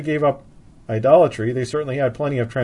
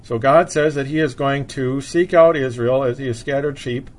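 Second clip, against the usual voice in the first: usual speaking voice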